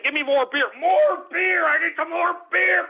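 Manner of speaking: high-pitched, whining yell; demanding holler. Real, not acted. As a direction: holler impatiently for a drink. Hollering for more beer.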